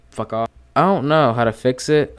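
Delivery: ordinary talk, nothing marked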